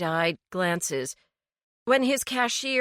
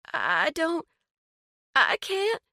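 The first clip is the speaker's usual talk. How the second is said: near tears; 8 semitones higher than usual. A narrator's reading. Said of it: "on the verge of tears".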